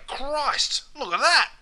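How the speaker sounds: high-pitched astonished oath; surprised exclamation. Acted. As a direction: exclaim in amazement at a sight. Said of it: "when they're surprised or angry".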